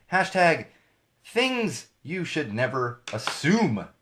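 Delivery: each word drawn out slowly; slow hashtag read. Shown as a demonstration. Host reading a hashtag to viewers, slow and deliberate. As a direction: savor every word, x0.5 slow.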